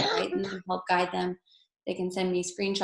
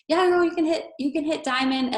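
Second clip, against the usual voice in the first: much higher, louder gushing promise; overeager hype; 8 semitones higher, 5 dB louder than usual. Retold or imitated. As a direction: hype them up, over the top. Mocking overpromising upline.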